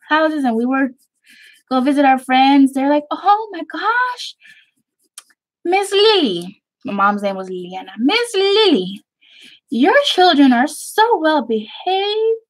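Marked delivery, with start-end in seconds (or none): 3.1-6.6 high, slow gushing church lady; swooning admirer voice. Retold or imitated. Friends' mothers praising her mom. 8.0-12.4 high, slow gushing praise; drawn-out sweet admiring voice; gushing lady. Retold or imitated. Friends' mothers praising her mom.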